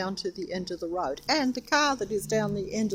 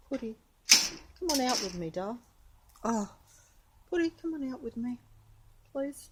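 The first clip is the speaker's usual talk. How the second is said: higher, quicker pleading pet talk; coaxing the cat; 5 semitones higher than usual. Real, not acted. Owner begging Putty to come out while holding the door, pleading pet talk.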